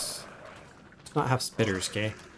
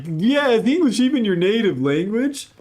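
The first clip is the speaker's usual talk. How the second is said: high, louder mocking critic; douchey mockery; 7 semitones higher, 4 dB louder than usual. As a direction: imitate a snotty commenter questioning someone. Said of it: "just totally, like, douchey".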